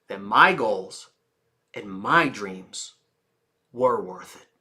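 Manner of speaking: gentle quiet conviction; soft resolve. Shown as a demonstration. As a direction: say it like a quiet vow.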